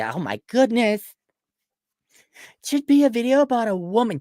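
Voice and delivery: imitating a popular girl pitching ideas; mock popular girl. Mocking the popular girls advising the director, gushy girl voice. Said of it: "did he consult the popular girls at the high school".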